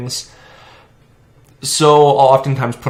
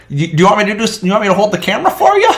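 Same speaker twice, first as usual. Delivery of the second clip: high, fast and louder offer; helpful old man; 11 semitones higher, 7 dB louder, faster than usual. Retold or imitated. Old man offering to hold camera, eager. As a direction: offer help eagerly, pitched up. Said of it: "he's like".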